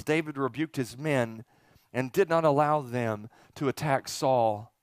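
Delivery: normal talking voice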